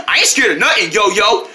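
normal talking voice

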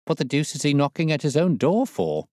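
bewildered at the knocking; cried out. A narrator's reading. The clerk, startled by knocking at the inner door. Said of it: "cried the clerk".

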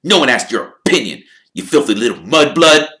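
fast, vicious, spitting sneer; hammed-up venomous spit; spat insult. A narrator's reading. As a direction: spit the slur at her. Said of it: "he spat".

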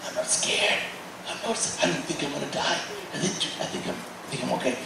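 soft, almost unvoiced wonder; hushed inner thought. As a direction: murmur it to yourself in disbelief. Inner thought after crusade.

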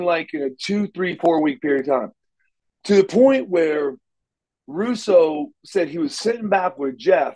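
ordinary talk

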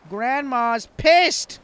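loud. Real, not acted. Loud slow exclamation.

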